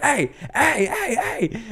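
high-pitched mock hollering; coach yelling. Tunisian coach running at the ref, high mock hollering.

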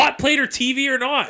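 loud, high protest; indignant shout. Real, not acted. Denying he wants to live on a roof, loud protest. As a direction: fire it back at him, exasperated. Heated roof argument with Josh.

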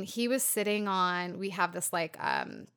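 normal talking voice

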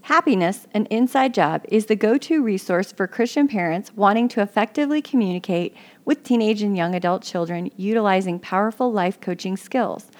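loud, punchy promo voice; ad read. Acted. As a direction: sell the show like a radio ad. Recorded promo pitch.

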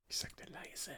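hushed whisper. Pretend. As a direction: whisper it for the minute of silence. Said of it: "Wir müssen jetzt leise sein".

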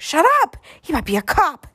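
put-on high, loud kid outburst; high-pitched, bickering little boy; urgent hushing scold. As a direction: shut him up in a panicky kid voice. Boy silences the other.

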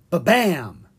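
loud mock knock; knocking sound. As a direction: bang on the door with your voice.

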